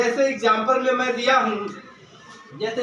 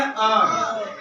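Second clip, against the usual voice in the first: ordinary delivery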